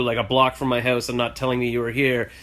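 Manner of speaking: ordinary talk, nothing marked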